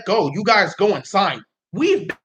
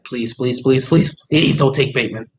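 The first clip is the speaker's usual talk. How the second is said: low, repeated pleas gaining force; pleading; 7 semitones lower than usual. Real, not acted. Fan begs the Jets not to take Bateman, pleading. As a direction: beg in a run of low pleases.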